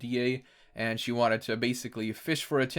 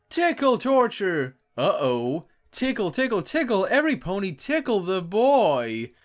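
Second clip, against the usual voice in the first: high, loud, giddy tickle chant; giggly tickle attack; 5 semitones higher, 3 dB louder than usual. Acted. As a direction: cheer it like gleeful fillies. Ponies shout tickle attack.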